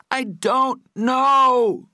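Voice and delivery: long, piercing high scream. A narrator's reading. Patrick tortured again for the money, screaming. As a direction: scream each word out at full force. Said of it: "Patrick's screams filled the house".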